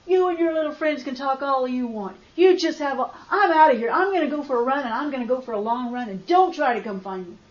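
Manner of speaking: loud furious shouting at her husband; angry outburst. Retold or imitated. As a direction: storm out, yelling that you're leaving. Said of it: "That made me so mad".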